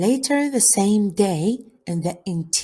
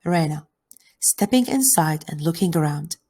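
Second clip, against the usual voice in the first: ordinary delivery, nothing marked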